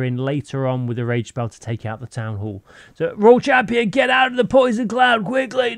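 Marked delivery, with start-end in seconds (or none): 3.1-5.8 high, loud call to get out now; urgent shout. Real, not acted. Commentator yelling at his Royal Champion, urgent high shout. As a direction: yell at the screen to save the hero.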